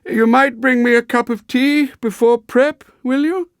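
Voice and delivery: put-on high, jerky elderly request; old schoolmaster calling to landlady; high-pitched old man. A narrator's reading. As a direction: ask for tea in a high, sprightly old voice. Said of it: "jerky, high-pitched voice".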